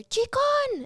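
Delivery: high, loud nickname holler; calling out. Retold or imitated. Co-stars calling each other across the sound stage, hollering. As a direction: sing it out high across a big room. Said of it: "We would call each other".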